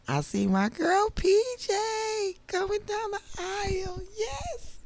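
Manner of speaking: very high excited squeal, stretched out; excited cheering. Real, not acted. Cheering her girl coming down the aisle, excited high squeal.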